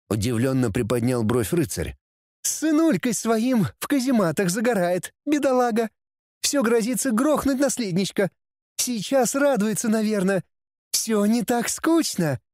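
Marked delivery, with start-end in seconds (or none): 2.4-12.4 high merry mocking demoness; cheerful demoness. A narrator's reading. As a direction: chuckle it out, gossipy and gleeful. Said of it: "весело хохотнула демоница".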